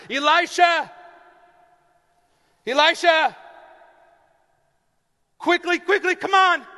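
loud, high frantic yell; frantic shouting. Acted. Servant yelling urgently through the house for the prophet. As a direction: shout to wake him, urgently.

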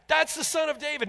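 high, loud shout, first of a building series. Retold or imitated. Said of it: "shouting it more and more".

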